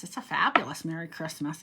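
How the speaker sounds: ordinary talk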